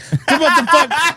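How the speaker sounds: very high, drawn-out bafflement with a laugh; mock disbelief. Retelling the baffled exchange at the party, mock disbelief. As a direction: squeal it slowly in mock disbelief. Acted reaction in the retold story.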